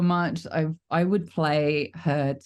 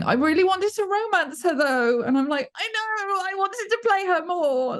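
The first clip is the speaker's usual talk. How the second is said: much higher, gushing fan quote; high excited fan voice. Retold or imitated. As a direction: squeal it like a disappointed fan.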